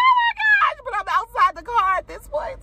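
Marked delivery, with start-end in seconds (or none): 0.1-0.8 very high panicked gasp of words; retold shriek. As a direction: shriek it at the flying bug. Bug flies across the car.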